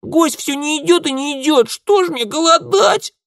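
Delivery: very high, loud wailing complaint; yelling child. A narrator's reading. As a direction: bawl your grievance like a little girl. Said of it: "вопила девчонка".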